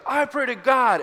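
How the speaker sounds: high, loud retold outburst; aggressive. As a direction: act out an angry insistence. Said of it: "he's getting really aggressive with Eid".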